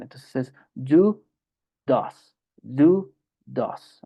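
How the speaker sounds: "do" and "does" with an English d, not the Spanish d; careful English d on do and does. Shown as a demonstration. Teacher practicing the English d sound, careful. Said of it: "una D diferente a la del español".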